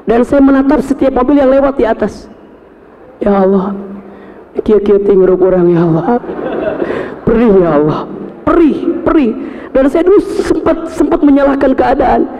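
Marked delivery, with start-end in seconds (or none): 3.2-9.3 anguished prayer. Retold or imitated. Retold prayer under the toll road.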